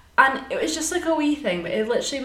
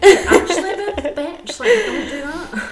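high, loud laughing scold of a friend; playful telling-off; mock blunt friend; 7 semitones higher, 6 dB louder than usual. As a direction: tell her off through a laugh, slowing down. Mimicking blunt straight-talking friends.

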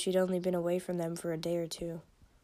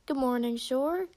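bright call, pitched up and louder; mildly yelled, higher-pitched hello; yelled greeting; 5 semitones higher, 4 dB louder than usual. A narrator's reading. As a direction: call good morning to a neighbour, rising at the end. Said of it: "Falcon yelled from the cage".

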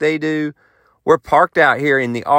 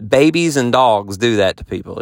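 ordinary talk, nothing marked

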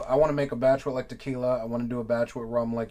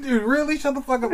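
mock incredulous; 11 semitones higher, 4 dB louder than usual. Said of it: "I'm like".

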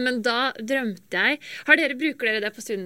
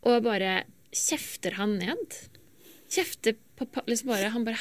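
ordinary delivery

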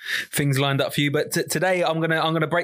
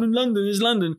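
higher-pitched mocking echo of a friend; mock imitation; 4 semitones higher than usual. Host mimics his friend going on about London, mocking imitation. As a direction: mimic his repeated boast, teasingly. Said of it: "he keeps saying".

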